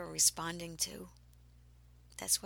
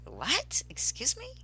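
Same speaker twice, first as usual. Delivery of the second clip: incredulous; 7 semitones higher, 4 dB louder than usual. Retold or imitated. Disbelief at shaman's advice.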